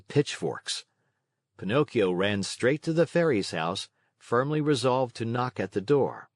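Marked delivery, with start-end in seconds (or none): none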